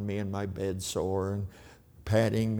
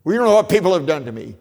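high, fast, shouted complaint; bitter shout; 8 semitones higher, 13 dB louder, much faster than usual. Pretend. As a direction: hurl it out, much higher and louder. Imagined bitter listener objecting.

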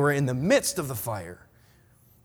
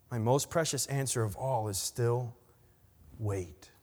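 low, soft, solemn poem reading; tender; 5 semitones lower, 5 dB quieter than usual. A narrator's reading. God gently closing his answer in poem.

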